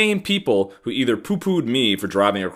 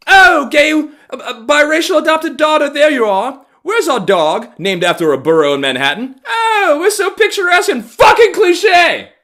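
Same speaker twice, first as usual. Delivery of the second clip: high, loud hammed-up calling out; mock them with shrieky, over-dramatic hollering; mocking stagey shout, building to a yell; theatrical neighbors; 9 dB louder than usual. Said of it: "so goddamn theatrical about everything they fucking do".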